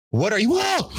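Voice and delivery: high, jumpy startled shout; startled cry. Retold or imitated. Teens startled by mom at three a.m., high frightened cry. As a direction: jolt in fright mid-question.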